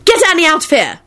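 high, urgent order; alarmed, high-pitched command; hasty. A narrator's reading. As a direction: send them away in a hurry. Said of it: "told Teddy hastily".

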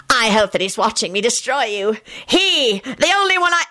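high, gloating cry cut short; gloat, rising high, then break off; gloating villain. A narrator's reading. Scully boasts of destroying them, gloating.

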